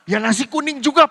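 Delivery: teasing imitation of the maid; mock repetition. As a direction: parrot the same reply again. Mimics maid's repetitive answer.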